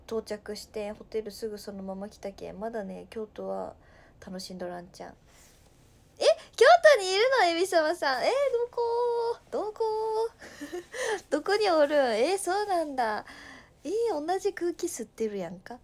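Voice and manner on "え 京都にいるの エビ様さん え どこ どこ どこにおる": high, loud, fast excited shriek; excited surprise. Real, not acted. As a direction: react like a friend is nearby. Viewer is in Kyoto too. (高い声で驚いて叫ぶ)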